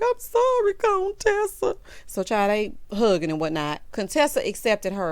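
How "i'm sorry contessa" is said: sweet apology. Retold or imitated. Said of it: "She's like".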